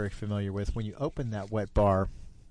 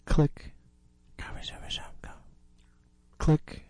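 mouth-made click of a walker; imitated walker click; 7 semitones higher than usual. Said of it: "it went something like this".

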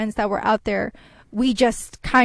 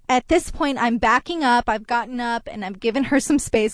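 ordinary talk, nothing marked

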